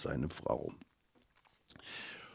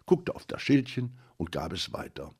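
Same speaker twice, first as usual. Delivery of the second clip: plain reading voice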